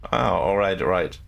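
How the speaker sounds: ordinary talk, nothing marked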